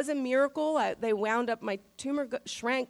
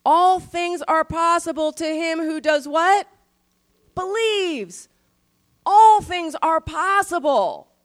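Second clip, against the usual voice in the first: louder, higher, slow and ringing; preaching proclamation; 5 semitones higher, 7 dB louder, slower than usual. Acted. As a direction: proclaim it to the whole church. Preacher proclaims verse with force.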